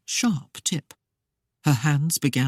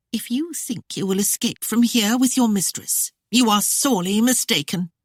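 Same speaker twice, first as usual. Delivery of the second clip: higher, threatening woman's voice; Claudia voiced; 6 semitones higher than usual. A narrator's reading. Claudia warning Christopher he won't escape, higher threatening voice. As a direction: warn him coldly.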